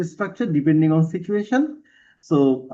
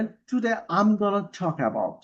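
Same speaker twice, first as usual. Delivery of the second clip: slow, careful demo of Today I'm gonna talk about; model phrase for introducing; much slower than usual. Shown as a demonstration. Teacher models an exam opener for students, slow and careful. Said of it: "এইভাবে শুরু করবেন".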